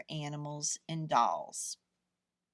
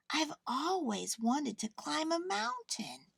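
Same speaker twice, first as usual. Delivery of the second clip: small teddy bear; 7 semitones higher than usual. A narrator's reading. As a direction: gently voice the toy bear.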